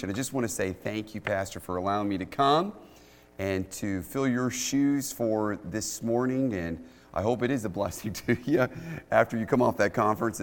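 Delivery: ordinary delivery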